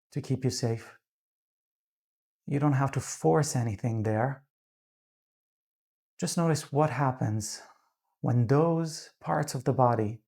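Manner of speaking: calm, unhurried meditation voice with long pauses; guided meditation. Real, not acted. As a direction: gently guide them into grounding, leaving space between phrases.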